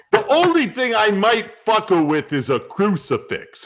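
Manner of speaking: brash New York comic rant; New York guy. Acted. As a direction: sneer it like a Queens stand-up. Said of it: "the New York guy".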